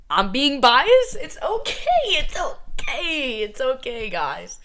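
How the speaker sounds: high, giggly reassurance; amused, high-pitched admission; laughing self-excuse. Real, not acted. Jokingly excuses her own bias.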